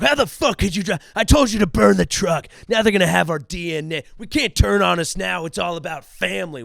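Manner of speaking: hammed-up gang boss losing his temper; angry heist leader. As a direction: chew out the crew for dropping the crown. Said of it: "they're arguing and they're pissed".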